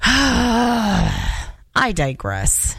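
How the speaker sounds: long tired sigh, then a slow aside; weary sigh. Real, not acted. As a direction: let out a heavy sigh and drop the topic. Weary sigh before moving on.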